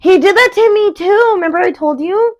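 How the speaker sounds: playful grievance at Carlos; indignant. Real, not acted. To her friend about Carlos, playfully indignant and high.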